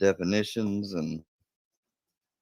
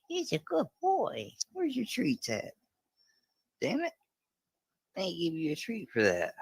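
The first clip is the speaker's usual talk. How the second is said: high pet voice; 12 semitones higher than usual. Real, not acted. Owner playing with her puppy Brownie, high sing-song baby talk.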